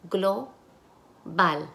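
Global split as "glo... bal" with a pause; syllable by syllable. Shown as a demonstration. Teacher asks for the syllables, syllable by syllable. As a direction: break Global into two separate syllables. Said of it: "Y ahora las sílabas".

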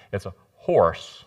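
Texas "horse" stretched out, with an extra syllable; Texas drawl. Shown as a demonstration. Preacher drawling "horse" as Texans say it. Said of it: "You have to add that extra syllable in".

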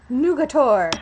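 loud, declaimed Latin jibe; playful, booming name-calling; mock Latin insult. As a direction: hurl it out loudly in mock insult. Starts insulting viewer in Latin.

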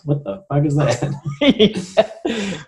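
high, drawn-out mock bafflement breaking into laughter; laughing disbelief. American clients questioning kumara in plans, laughing disbelief.

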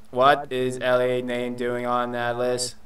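slow, monotone robot. Acted. Robot asking about Ellie on the list, slow robot voice. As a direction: question slowly on one robotic note. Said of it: "try and do that robot voice".

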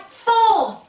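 plain reading voice

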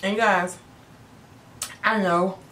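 swallowing between words; talking while chewing. Real, not acted. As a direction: mouth partly full. Seen 0: Cheeks full, lips pressed after onion bite; clears by 2.9s.

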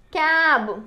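"క్యాబ్" loud, long vowel, falling; pronunciation demo. Shown as a demonstration. Teacher modelling the blended word, "క్యాబ్" loud and drawn out. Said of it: "ఎలా పలుకుతుందో చూద్దాము".

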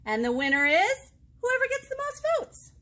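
high, loud big reveal; bright storybook build-up; excited announcement. Acted.

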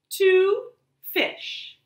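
ordinary delivery, nothing marked

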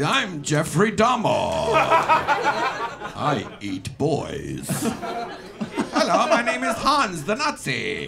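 slow, mock-sinister puppet voices; rejected puppets. Comic voicing the rejected puppets, slow and hammed-up. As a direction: introduce the rejected dummies. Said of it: "It's like".

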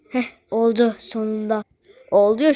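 ordinary talk, nothing marked

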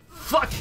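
real coughing fit; sputtering, choking coughs. Real, not acted. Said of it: "I'm choking".

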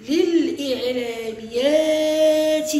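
للإعلانيات drawn out slowly, sounding each part; sing-song spelling. Shown as a demonstration. Teacher sounding out للإعلانيات for the class, slow and stretched. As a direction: stretch للإعلانيات so the pupils hear the added lam. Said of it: "بعد إضافة اللام المكسورة للإعلانيات".

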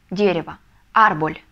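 ordinary talk, nothing marked

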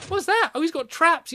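high, jumpy exclamation; startled alarm. Real, not acted. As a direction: blurt it out after a sudden fright. Startled by a trap.

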